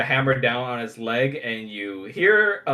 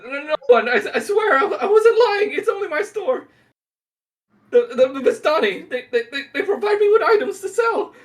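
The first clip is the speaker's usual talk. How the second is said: very high, louder, stuttering plea with nervous laughs; terrified stammer; 5 dB louder than usual. Acted. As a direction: swear innocence while trembling. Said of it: "He says".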